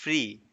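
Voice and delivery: "फ्री" with a long ee at the end. Shown as a demonstration. Teacher showing the double e, long ee in "फ्री". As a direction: stretch the ee in "फ्री". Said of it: "double ई मिलके बड़ी वाली ई बनाता है".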